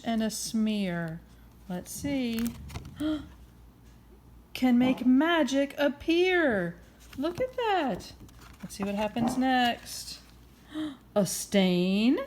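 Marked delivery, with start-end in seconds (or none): none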